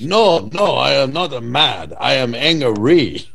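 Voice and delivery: put-on Chinese accent, slow "angry"; hammed-up Chinese accent, "angry" stretched as his name; mock Ang Lee accent. Ang Lee insisting on his name, mock Chinese accent. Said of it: "impression of Ang Lee".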